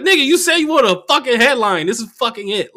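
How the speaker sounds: loud, high-pitched comeback; exasperated retort. Retold or imitated. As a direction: snap back in exasperation. Acting out retort to comic.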